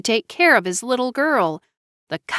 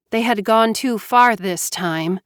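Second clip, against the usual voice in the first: usual speaking voice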